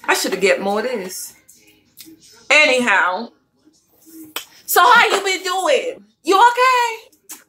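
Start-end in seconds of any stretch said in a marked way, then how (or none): 4.6-7.4 sing-song high friendly question; playful check-in. Real, not acted. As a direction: ask it way up high, stretching it out. High-pitched check-in with the viewer.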